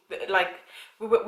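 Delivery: ordinary delivery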